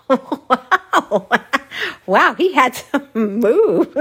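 teasing her sister, cracking up; laughing tease. Retold or imitated. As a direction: draw it out through giggles.

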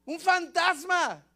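high, drawn-out cry of alarm; frightened shout. Acted. Disciples see Jesus walking on water, frightened shout. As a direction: shout it slowly, high and loud. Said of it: "los discípulos lo ven a lo lejos y gritan".

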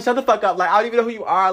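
high, loud mock-angry comeback; irritated retort. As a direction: act out an exasperated telling-off. Snapping back at remembered Facebook message.

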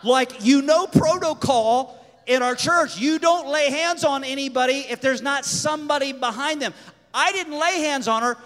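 loud protest. Retold or imitated. Storyteller defending himself over church protocol, loud protest. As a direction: protest your innocence loudly and insistently.

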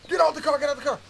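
high, loud, urgent shouted command; shouted order. Retold or imitated. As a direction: shout them out of the car. Soldiers order them out of car.

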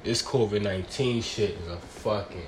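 ordinary talk, nothing marked

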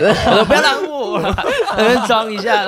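high, giggling retelling, slow at the end; laughing while telling. Real, not acted. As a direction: laugh through the bravado. (边笑边讲)

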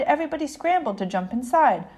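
usual speaking voice

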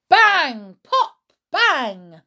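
loud mock bangs and pops; popping sounds. A narrator's reading. Narrator voicing the balloons bursting, loud bangs.

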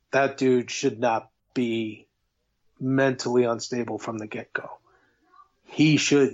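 usual speaking voice

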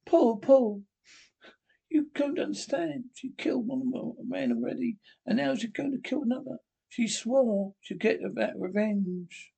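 high, urgent, frightened wife; frightened pleading. Acted. Wife begging Paul to understand, frightened and high.

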